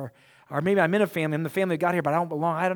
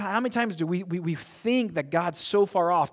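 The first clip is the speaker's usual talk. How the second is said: normal talking voice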